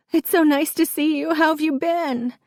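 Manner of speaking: high, tearful politeness; sobbing small talk. A narrator's reading. Crying mother greets Gus politely, high tearful voice. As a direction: squeak out pleasantries through sobs. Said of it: "I sob".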